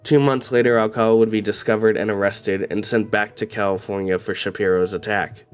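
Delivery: ordinary delivery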